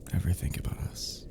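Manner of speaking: whispered nervous question. Acted. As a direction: breathe it out, barely voiced.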